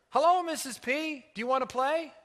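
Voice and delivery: put-on high, loud little girl's greeting; eager child. A narrator's reading. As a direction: pipe up eagerly, wanting a playmate. Child suddenly appears, greets her.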